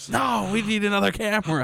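high-pitched mock obsession; frantic insistence.

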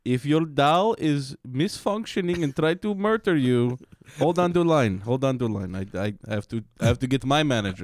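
put-on Indian call-centre accent. Agent handling a killer doll call, Indian accent. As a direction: recite support advice in a mock Indian accent. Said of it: "he didn't have that accent".

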